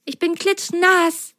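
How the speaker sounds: high, shivering little sock voice. Acted. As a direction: shiver through the words, cold and wet. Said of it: "ertönte plötzlich eine bibbernde Stimme". (bibbernde Sockenstimme)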